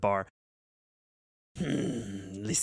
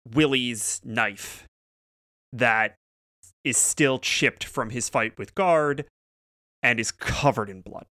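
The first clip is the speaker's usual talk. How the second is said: normal talking voice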